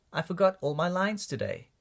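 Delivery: ordinary delivery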